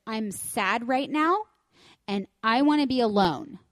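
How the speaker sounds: mock pouty child setting terms; little girl's voice. Daughter Iris voiced in story.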